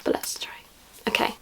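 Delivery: hushed whisper before spraying. Real, not acted. Testing a perfume spray for viewers, whispering. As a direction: breathe it out, no voice, like a secret.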